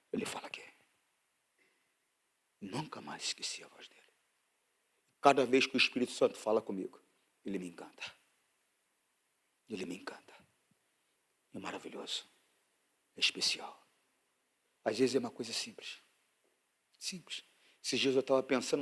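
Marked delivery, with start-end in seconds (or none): 0.1-0.6 whispered, much softer; hushed whisper of where He speaks. Shown as a demonstration. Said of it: "Mas ele não fala aqui". 2.6-17.4 low, much softer, half-whispered testimony; tender, breathy sermon confession; intimate hushed preaching. Acted.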